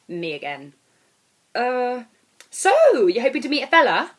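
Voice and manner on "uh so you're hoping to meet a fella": high, loud and fast nosy question; pry eagerly, pitched way up; brash nosy girl. Acted. Airport girl quizzing the narrator, high and loud.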